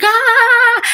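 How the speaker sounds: startled high scream at loud car music. Retold or imitated. Recalling her car music blasting, a high startled scream. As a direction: yelp like the music blasted you. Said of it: "I'm screaming".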